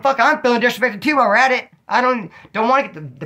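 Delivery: high, loud, heated pushback; defiant retort. Retold or imitated. Refusing the hardcore dude's beer run, loud heated defiance. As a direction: throw the insult right back at him.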